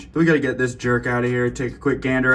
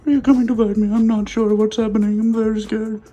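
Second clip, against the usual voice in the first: very high, louder mock-scared pet voice; baby talk to pet; 12 semitones higher, 6 dB louder than usual. Keeper squeals in baby talk, mock scared of a bite. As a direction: ham up being scared of the snake.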